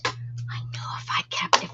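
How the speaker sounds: murmured whisper to herself. Real, not acted. Whispering to herself while searching.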